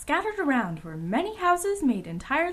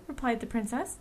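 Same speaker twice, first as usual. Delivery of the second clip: usual speaking voice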